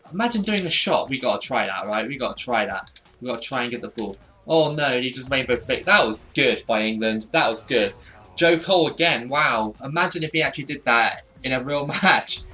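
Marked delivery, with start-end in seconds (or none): none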